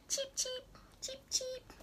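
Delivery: high, soft, tiny cheeping; chick cheeps. Retold or imitated. Reading to a toddler, high soft chick cheeps. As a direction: peep like a little chick. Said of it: "What sound do her babies make?".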